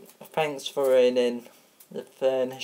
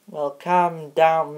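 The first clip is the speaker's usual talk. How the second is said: ordinary talk, nothing marked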